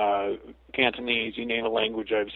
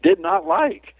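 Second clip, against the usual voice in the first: high, loud mock pout; mock childish complaint; comic disapproval; 5 dB louder, much slower than usual. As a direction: squeak it out as a joke. Joking punchline about fear.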